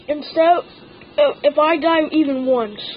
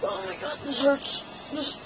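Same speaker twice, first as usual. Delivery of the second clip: strained pained murmur; 12 dB quieter than usual. Real, not acted. Clothespin pain, near silent.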